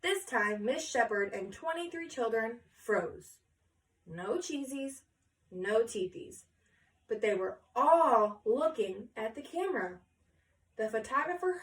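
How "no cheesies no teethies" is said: ordinary delivery, nothing marked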